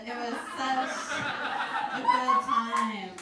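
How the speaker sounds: laughing through the line; can't hold back a laugh. Real, not acted. Nostalgic sarcastic memory of fight.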